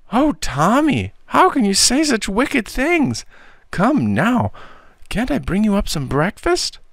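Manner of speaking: very high-pitched, fussing auntie voice; horrified, coaxing aunt. Acted. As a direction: gasp in dismay, then coax sweetly up high. Narrator plays the horrified aunt.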